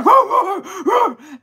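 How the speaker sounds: loud, high frantic barks; imitated dog barking. Imitating Mai Tai over here, frantic loud barking. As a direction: bark like a dog gone crazy. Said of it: "And he's over here".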